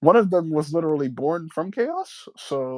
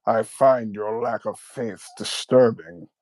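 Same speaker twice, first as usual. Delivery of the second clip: slow, low, menacing Vader line; Darth Vader voice; 5 semitones lower, slower than usual. Retold or imitated. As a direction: intone it like Vader. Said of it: "a good Darth Vader voice".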